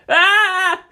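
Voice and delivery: sharp, high, loud war shout; defiant battle yell. A narrator's reading. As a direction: answer with a fierce yell. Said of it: "retorted Shinji".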